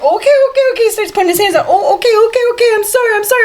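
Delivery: high, panicky mock backpedalling; freaked-out man. Woman mimics the flasher once she screams, mock panic. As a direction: imitate a flustered man apologising at a high pitch. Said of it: "He gets freaked out".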